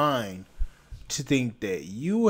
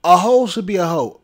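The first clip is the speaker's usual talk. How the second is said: angry; 3 semitones higher, 6 dB louder than usual. Real, not acted. Angry rant at a woman.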